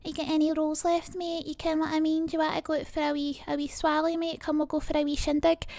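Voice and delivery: twangy Glaswegian accent, pushed up into the nose. Shown as a demonstration. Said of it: "that's where the Glasgow accent comes from".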